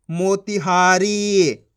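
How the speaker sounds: मोतिहारी very slow, every syllable stretched; blended word reading. Shown as a demonstration. Teacher reading the joined word, slow blending. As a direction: join मो-ति-हा-री slowly. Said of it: "आपस में लाके पढ़ दो".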